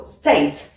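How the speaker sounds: "State" punched as one falling syllable; stress on whole word. Shown as a demonstration. Teacher shows pupil the punch, whole word stressed. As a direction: put pep into all of "State", pitch dropping. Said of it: "un coup de peps comme ça sur tout le mot".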